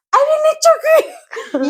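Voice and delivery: high, slow playful yell with a laugh; yelling to spook the sibling on the stairs; retold yell. Kid yelling on the dark stairs to scare her brother, high and laughing.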